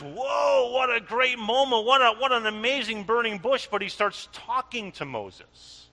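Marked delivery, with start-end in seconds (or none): 0.0-3.7 mock awe. Mock awed reaction.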